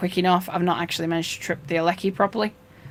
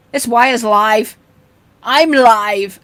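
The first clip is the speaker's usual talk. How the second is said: dad's slow loud warning; 7 dB louder, much slower than usual. Retold or imitated. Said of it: "And he'd go".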